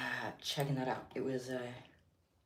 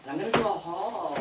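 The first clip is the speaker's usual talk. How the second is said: louder, higher hype announcement; excited; 6 semitones higher, 6 dB louder than usual. Real, not acted. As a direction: hype up the haul.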